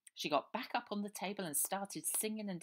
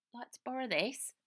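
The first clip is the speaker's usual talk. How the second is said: put-on child voice; 8 semitones higher, 8 dB louder than usual. A narrator's reading. Baby offers jacket.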